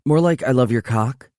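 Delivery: put-on playful girlfriend voice; Holly's voice. A narrator's reading. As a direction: tease him in her brighter voice.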